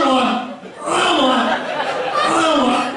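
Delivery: ordinary delivery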